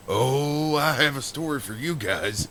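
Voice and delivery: lightly voiced eager friend, drawn-out oh; eager storyteller quote. Friend announcing his story to the guys, lightly imitated. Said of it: "So he said".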